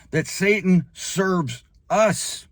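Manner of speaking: slow emphatic. Acted. Revelation climax, drawn out.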